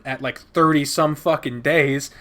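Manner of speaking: usual speaking voice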